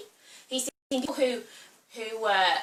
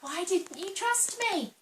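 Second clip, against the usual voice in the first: gentle Jesus; 8 semitones higher, 3 dB quieter, slower than usual. Acted. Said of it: "he says to Peter".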